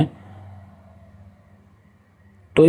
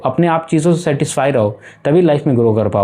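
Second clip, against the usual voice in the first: ordinary delivery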